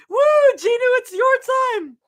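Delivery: very high, loud squealing cheer; excited whoop. Real, not acted. Streamer cheering Jinu on for his moment, squealing excitement. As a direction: whoop as if hyping someone onto stage. Streamer hypes the character's entrance.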